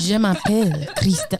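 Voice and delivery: slow, put-on French accent; mock French accent. Host shows off her French for the co-host, in a slow mock French accent. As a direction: lay on a dreamy French accent, slowly. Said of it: "I love me a French accent".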